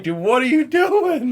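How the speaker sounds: high, slow, laughing disbelief; incredulous. Retold or imitated. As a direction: ask it in amused disbelief.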